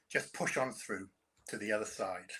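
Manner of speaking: ordinary delivery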